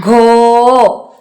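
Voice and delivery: ഘോ stretched very long and clear; a teacher drawing out ഘോ; slow model answer. Shown as a demonstration. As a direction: hold ഘോ out for children to echo. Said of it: "അല്ലേ?".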